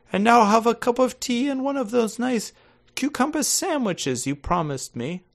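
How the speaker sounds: put-on high, grand old lady voice; haughty aristocratic aunt, hammed-up; Lady Bracknell. [name] reads Lady Bracknell to her nephew, haughty high voice. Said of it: "you're gonna be playing Lady Bracknell".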